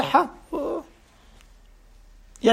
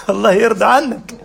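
ordinary talk

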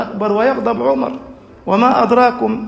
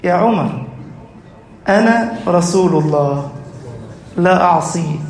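the Prophet calming angry Umar, low and gentle; gentle reply; 4 semitones lower than usual. Acted. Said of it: "أجابه النبي في رفق".

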